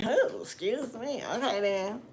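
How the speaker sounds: slow and meek, voice put on; backing down, humbled and sheepish; a man put in his place, apologising meekly; chastened. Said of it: "That sucker was checked like".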